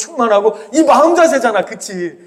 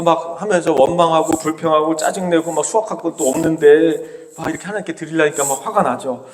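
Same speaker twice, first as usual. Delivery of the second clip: ordinary talk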